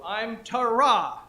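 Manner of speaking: Tara slow, with a loud, long final "ra"; stress on last syllable. Shown as a demonstration. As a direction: punch the back half of Tara. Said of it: "the last syllable".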